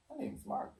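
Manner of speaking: high unvoiced whisper; whispered correction. Margaret correcting people about her name, a high whisper. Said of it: "Margaret tells everybody".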